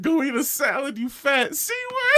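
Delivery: high-pitched mock yell, climbing; laughing re-enactment of a shouted insult; hurled insult. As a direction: draw out the last words in a retold jeer. Imitating friend's shouted insult.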